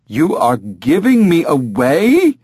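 slow, high, incredulous outrage; horrified. Acted. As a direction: draw it out in appalled disbelief. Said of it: "in a horrified tone".

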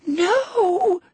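loud, very high shriek. A narrator's reading. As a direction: shriek it in desperation. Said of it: "she shrieked".

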